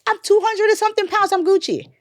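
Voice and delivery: high, loud and determined; fired up. Retold or imitated. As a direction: push through with fierce resolve. Retold determination, high pitch.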